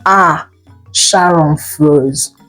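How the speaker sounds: ordinary delivery, nothing marked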